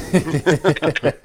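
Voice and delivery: a burst of high, loud laughter; real laugh. Real, not acted. Father laughing after his son says I told you, loud. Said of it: "I just kinda laughed".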